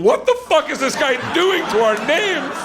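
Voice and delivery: high, exasperated acted complaint; mock outrage. Famous women in heaven complaining about him, indignant. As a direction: protest in exaggerated indignation.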